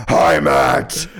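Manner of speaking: low, loud put-on voice; low shouted voice. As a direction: boom out a low greeting. Answering with a put-on voice.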